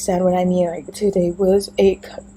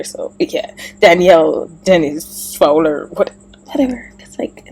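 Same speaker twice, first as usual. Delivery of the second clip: ordinary talk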